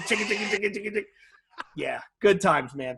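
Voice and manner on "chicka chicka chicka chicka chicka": jingle noise. Retold or imitated. Shaking keys on piercing.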